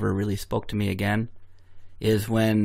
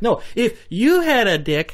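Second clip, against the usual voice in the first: laughing; 12 semitones higher, 4 dB louder, slower than usual. Acted. Laughing put-on high voice.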